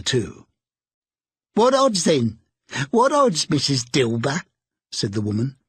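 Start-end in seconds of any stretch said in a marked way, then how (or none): 1.5-4.4 defiant charwoman. A narrator's reading. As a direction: toss it out boldly.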